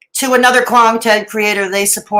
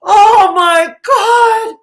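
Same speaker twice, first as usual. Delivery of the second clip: high, loud, hammed-up horror; mock shock; 9 semitones higher, 4 dB louder, much slower than usual. Reactor mocking fuss over a super chat, mock shock. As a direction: overplay a scandalized gasp, drawn out.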